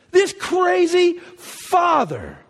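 voice breaking. Real, not acted. Preacher moved to tears by the father's love, voice breaking. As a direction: let your voice crack on this crazy father. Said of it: "repeat with cry".

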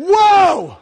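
huge, high, drawn-out shout of amazement; stunned awe. Acted. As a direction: act out being blown away, at full volume. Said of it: "it's like".

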